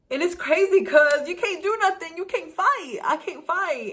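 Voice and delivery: venting hard at the unfairness; frustrated outburst. Real, not acted.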